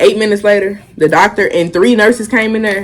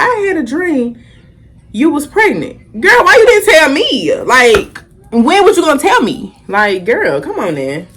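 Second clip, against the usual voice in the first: grandma voice pitched much higher, scolding; imitating grandma; 6 semitones higher than usual. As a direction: play grandma fussing at her granddaughter.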